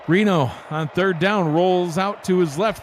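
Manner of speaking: real sportscaster excitement; excited play-by-play. Real, not acted. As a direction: call the interception as it happens, voice leaping up. Live call of an interception.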